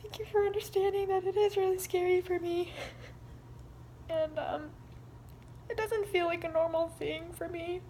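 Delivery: high, tearful voice; real tearful confession, pitched high; trying not to cry. Real, not acted. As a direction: push the words out through tears. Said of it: "try not to cry".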